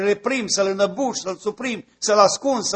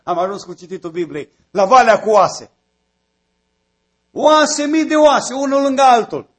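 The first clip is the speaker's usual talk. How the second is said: fervent pulpit preaching, higher and louder; building sermon cry; preaching at full force; 5 semitones higher, 7 dB louder than usual. Acted. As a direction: thunder it from the pulpit to stir the church.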